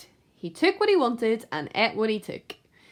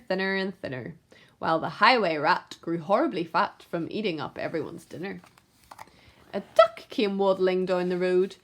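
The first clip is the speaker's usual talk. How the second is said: ordinary delivery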